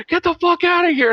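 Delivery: high, loud scream imitating an angry brother; retold scream. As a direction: imitate being yelled out of the room. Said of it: "looks at me and screams".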